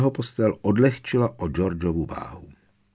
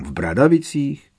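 higher, louder questioning burst; blurted guess; 7 semitones higher, 6 dB louder than usual. Acted. Ron guessing the hiding place, blurted. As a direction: blurt it out, pitch jumping up. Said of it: "vyhrkl okamžitě Ron".

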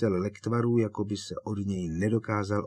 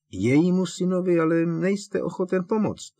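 much higher, pointed accusation; accusing; 10 semitones higher, 6 dB louder than usual. Acted. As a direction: throw the blame at him. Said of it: "obvinil ho Harry". (obviňující)

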